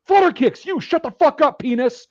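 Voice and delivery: high, forceful barked order; imitated drill sergeant yell. Drill sergeant shutting up the kid who gave advice, imitated yell. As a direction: yell it like a sergeant handing out punishment.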